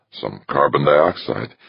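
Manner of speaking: plain reading voice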